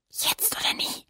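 voiceless urgent whisper. Acted. Lilli to Flosse before rushing past, urgent whisper. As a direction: whisper it as you dart off. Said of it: "flüsterte Lilli".

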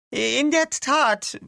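very high, slow, loud character voice; drawn-out confirmation. Acted. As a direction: stretch it out high and emphatic. Concord's drawn-out confirmation in story.

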